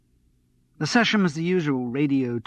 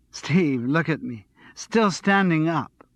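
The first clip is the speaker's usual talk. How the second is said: lightly voiced bubbly flatmate, higher; giddy and a little tipsy, pitched up; a hint of a breathless, excited girl; excited tipsy young woman; 6 semitones higher, 7 dB louder, slower than usual. Andrea, excited, slightly drunk.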